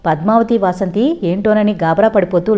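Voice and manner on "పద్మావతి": usual speaking voice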